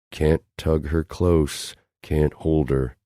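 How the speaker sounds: ordinary delivery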